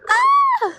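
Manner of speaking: sudden high-pitched yelp of fright; a sharp scared cry; startled yelp. Real, not acted. Startled while riding in the dark.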